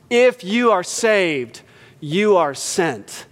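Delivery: each word placed slowly, with weight; slow down and land the point; preacher driving home the main line, slowly; emphatic slow. Acted. Sermon key line, slowed.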